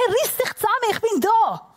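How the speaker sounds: high-pitched, exasperated retold scolding; imitating a fed-up dad; annoyed father. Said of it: "hat er sich genervt und gesagt".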